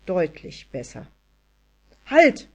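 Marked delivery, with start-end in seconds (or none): 2.1-2.5 loud, high shout; roared command. A narrator's reading. Anja tries to stop her dog, roaring. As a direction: yell to halt the dog. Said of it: "brüllte sie".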